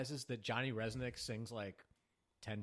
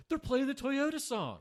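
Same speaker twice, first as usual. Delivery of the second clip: high, loud, stretched-out mock excitement; hammed-up squeal of recognition; excited concertgoer; 6 dB louder than usual. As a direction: exclaim it like a thrilled fan. Acting out a concertgoer recognizing song.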